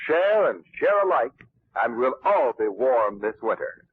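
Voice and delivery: usual speaking voice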